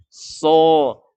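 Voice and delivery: loud, long SO; thick sod. Shown as a demonstration. Teacher demonstrates isti'la, thick so. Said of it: "lalu pangkal lidah diangkat".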